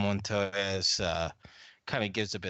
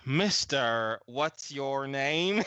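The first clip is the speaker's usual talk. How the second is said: slow, drawn-out Southern drawl; loud, stretched Southern-accented hail; strange Southern accent; 2 semitones higher, 4 dB louder, much slower than usual. Acted. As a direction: disguise the voice with an odd Southern accent. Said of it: "in this really strange, uh, Southern accent".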